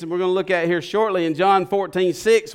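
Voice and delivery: usual speaking voice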